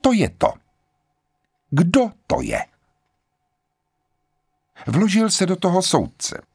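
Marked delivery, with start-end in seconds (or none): 0.0-2.7 sharp, high-pitched interruption; pointed, high challenge. A narrator's reading. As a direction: cut in sharply, pitch raised. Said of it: "Řekla ostře".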